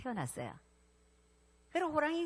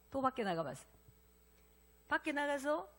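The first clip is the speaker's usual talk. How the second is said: ordinary talk, nothing marked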